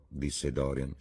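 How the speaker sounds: usual speaking voice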